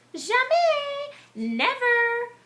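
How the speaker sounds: high, loud defiant cry; gingerbread boy crying out. Acted. Gingerbread boy refusing the farmer with a high, loud cry. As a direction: shout it back at the farmer. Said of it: "cried the gingerbread boy".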